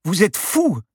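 high, loud explosion of outrage; outraged passenger yelling; furious outburst. A narrator's reading. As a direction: explode at the reckless pilot. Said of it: "explosa-t-elle".

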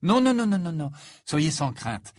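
high, loud rush of denial; quick reassurance. A narrator's reading. Harry to Hagrid, reassuring him hurriedly. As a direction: brush the worry away fast.

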